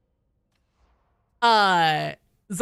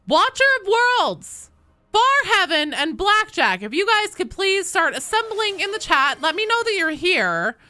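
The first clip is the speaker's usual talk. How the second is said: high, loud roll call; announcer-like call to assemble; 11 semitones higher, 4 dB louder than usual. Real, not acted. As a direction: summon the players with a big raised voice. Calling players to assemble in chat.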